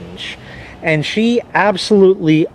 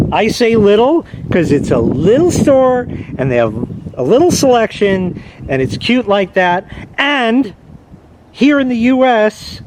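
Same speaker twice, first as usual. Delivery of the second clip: ordinary delivery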